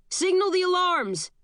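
high-pitched alarm call, not full volume; restrained scream. Acted. Guard at the checkpoint as the bomb goes off, restrained scream. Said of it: "guards at the security checkpoint screams".

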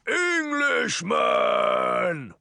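slow, drawn-out monstrous roar; huge rumbling giant voice; booming growl. A narrator's reading. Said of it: "a booming voice growled".

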